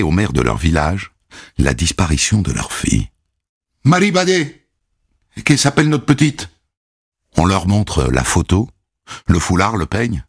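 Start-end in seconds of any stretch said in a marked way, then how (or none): 3.8-6.5 anxious parent. Acted. Grieving parents voiced.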